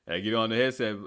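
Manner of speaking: usual speaking voice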